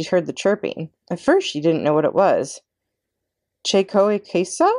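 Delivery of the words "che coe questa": slow Italian question, higher. A narrator's reading. Mama wonders aloud in Italian at the chirping. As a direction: ask it as a puzzled Italian mother. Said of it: "she said in Italian".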